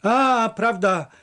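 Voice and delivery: high, amused comeback; laughing retort. A narrator's reading. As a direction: tease back with a touch of laughter. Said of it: "zaśmiał się Paweł".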